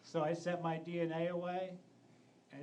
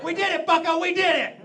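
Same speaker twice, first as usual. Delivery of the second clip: triumphant cheer; 10 semitones higher, 16 dB louder than usual. Pretend.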